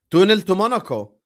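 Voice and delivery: normal talking voice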